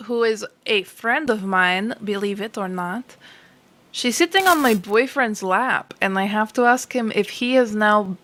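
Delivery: ordinary delivery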